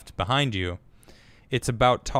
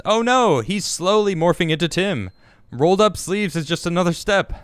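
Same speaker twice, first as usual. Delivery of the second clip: higher, louder mock-alarmed reading; mock dismay; 8 semitones higher, 5 dB louder than usual. Host reading a teasing viewer comment aloud, mock dismay. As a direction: read it out as a playful "oh no".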